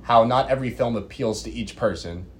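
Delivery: normal talking voice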